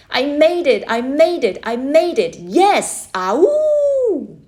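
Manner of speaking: loud, high victory whoops; triumphant yell. Acted. Boy whoops across the river, triumphant yell. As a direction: cheer as loud as you can. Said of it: "my final triumphant yell".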